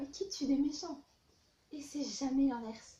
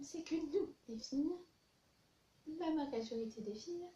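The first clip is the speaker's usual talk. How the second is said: normal talking voice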